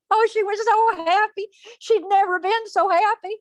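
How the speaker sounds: high, gushing storyteller delight; elated, high-pitched narration; overjoyed. A narrator's reading. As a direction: bubble over with happiness for her. Narrator voices Ashpet's joy.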